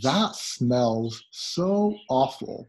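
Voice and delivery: slow and clear for learners. Shown as a demonstration. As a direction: read it out slowly, word by word. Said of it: "slow teaching take".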